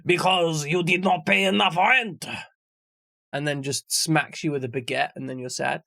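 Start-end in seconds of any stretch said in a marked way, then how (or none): 0.0-2.3 slow, mopey French accent, lightly put on; mock sad Frenchman. Imagining the depression Frenchman, in a slow mock French accent. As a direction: drag it out like a gloomy Frenchman with a baguette. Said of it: "is it some sad Frenchman like".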